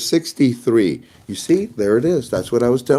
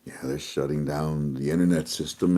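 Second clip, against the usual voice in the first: much softer and lower, whispered; hushed aside; 7 semitones lower, 11 dB quieter than usual. Real, not acted. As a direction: drop to a conspiratorial whisper. Hushed aside about internet shutdown.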